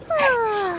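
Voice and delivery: high, soft yawning sigh; put-on yawn, high and soft; demonstrated yawn. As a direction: let out an airy yawn. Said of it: "Or you just yawned".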